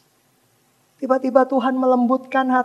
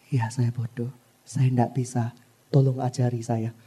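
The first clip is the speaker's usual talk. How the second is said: much softer, lower, gentle admission; humble and soft; 9 semitones lower, 8 dB quieter than usual. Retold or imitated. Retelling how he humbly answered the mocking friend, soft and meek. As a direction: lay yourself down humbly.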